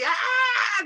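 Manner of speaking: high, hysterical demo scream; full-out scream. Shown as a demonstration. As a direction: scream like a crazy woman, demonstrating. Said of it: "el grito pelado, o sea, gritar así".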